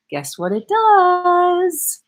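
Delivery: high and drawn out slowly; playful teasing question. Real, not acted. Coach teasing the group before the answer, playful and slow. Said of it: "teasing build-up to the point".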